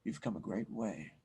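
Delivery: low, soft, unhurried sage; soft, wise mythic voice. Acted. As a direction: speak like an ancient monk who has seen it all. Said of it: "the soft, very wise, and almost mythic voice".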